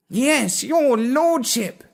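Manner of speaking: high, slow fawning cat voice; servile cat. Acted. Cat bowing to its lord, high and simpering. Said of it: "the cat said".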